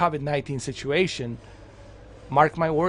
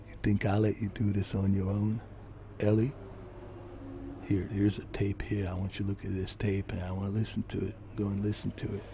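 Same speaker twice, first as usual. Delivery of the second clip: low, quiet gruff Joel; half-growled, tired father figure; 7 semitones lower, 8 dB quieter than usual. Retold or imitated. Joel talking to Ellie about a tape. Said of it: "to do the Joel and say".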